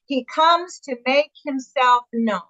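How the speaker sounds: very slow, every word weighted; slow emphatic refrain. Shown as a demonstration. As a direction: lean into each word like a solemn refrain. Refrain repeated slowly, weighted.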